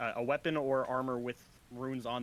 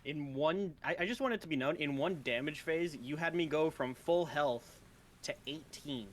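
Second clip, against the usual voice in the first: excited; 5 semitones higher than usual. Real, not acted. Outraged at damage taken.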